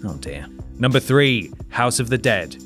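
ordinary talk, nothing marked

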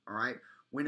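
normal talking voice

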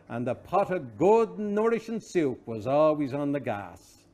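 usual speaking voice

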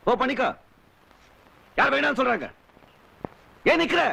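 high-pitched, furious dismissal; angry outburst. Acted. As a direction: send them away in rising anger. Angry lovers' quarrel, sending her away. (கோபமாக உச்ச குரலில் விரட்டுவது)

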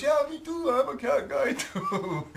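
very high, loud, laughing mimicry; mock enthusiasm, voice shooting up; imitated excited agreement. Neighbour agreeing he's a cat guy too, mock-excited yell. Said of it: "And he says".

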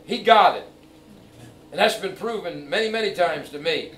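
ordinary talk

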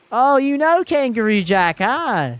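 high, loud delighted tease; excited. Real, not acted. Streamer to chat, delighted someone knows the movie. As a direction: squeal it at the viewer.

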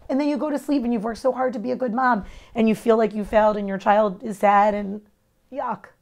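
ordinary talk, nothing marked

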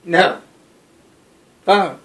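insist, no arguing; loud demand. Real, not acted. Demanding the phone from a friend who is recording, loud and firm.

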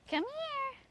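very high falsetto animal call; high-pitched coaxing. Real, not acted. Owner coaxing Beetle inside, high-pitched call. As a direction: coax the pet in a very high voice.

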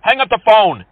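irritated order building to a shout; bad-tempered. Real, not acted. Caller in a bad mood dismissing an insurance telemarketer, bad-tempered. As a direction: lose patience and end up yelling. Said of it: "I'm in a bad mood".

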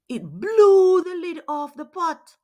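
loud, high burst of excitement; excited. Acted. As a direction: build to a loud peak. Lid bursts off pot.

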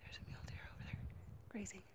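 whispering so the deer won't spook; soft breathy whisper, barely voiced; hushed whisper. Real, not acted. Hiker whispering to viewers about a grazing deer, hushed whisper. Spotting a mule deer quietly.

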